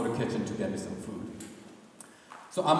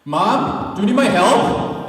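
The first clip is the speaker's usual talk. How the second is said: loud and higher, calling to the kitchen; calling out to mom; 9 semitones higher, 14 dB louder, slower than usual. Retold or imitated. Son escaping the awkward silence, calling loudly to mom. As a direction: grab the excuse and shout for mom.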